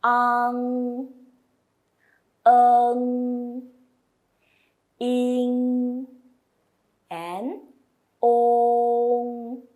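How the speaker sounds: ang, eng, ing, ong said loud and clear; teacher modelling sounds; Mandarin finals. Shown as a demonstration. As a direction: project each nasal final. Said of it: "the third line is".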